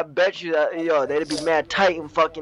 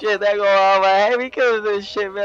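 much higher and louder, half-crying pleas; panicky cockroach begging; 7 semitones higher, 5 dB louder than usual. Acted. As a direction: wail like a traumatized bug begging for mercy. Cockroach pleading after being stepped on.

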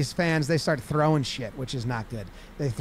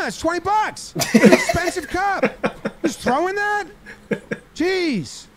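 high-pitched indignant yelping; hammed-up scandalized protest; mock alarm; 10 semitones higher, 3 dB louder than usual. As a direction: cry out in outraged disbelief, voice way up. Acts out bullpen member dodging thrown cups.

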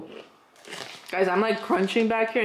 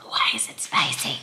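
whispering; 6 semitones lower, slower than usual. Real, not acted. Tasting chips, muttering aside.

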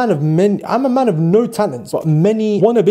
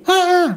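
short high mock scream; 13 semitones higher than usual. Vlogger introducing Scream, high mock scream.

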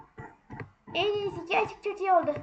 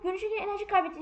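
normal talking voice

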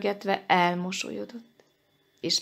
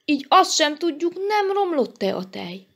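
higher, louder protest; indignant scolding; 7 semitones higher, 4 dB louder than usual. A narrator's reading. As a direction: scold them with rising pitch. Elemér's indignant scolding reply.

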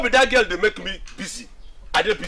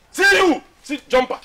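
sharp, raised scorn; scornful outburst; 6 semitones higher than usual. Acted. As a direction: throw it at him, pitched way up. Insulting friend in quarrel.

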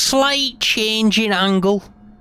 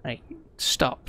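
whisper to self; 5 semitones lower, 9 dB quieter than usual. Real, not acted. Urging himself on after a slip.

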